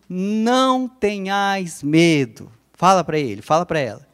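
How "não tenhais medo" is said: "Não tenhais medo" drawn out slowly, each word weighted; slow modelled phrase. Shown as a demonstration. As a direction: say "Não tenhais medo" slowly with falling tune for them to repeat. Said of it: "Diz assim". ("Não tenhais medo" bem devagar, palavra por palavra)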